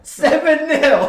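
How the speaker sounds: high, stretched-out disbelief; can't believe the score, laughing; incredulous. Real, not acted.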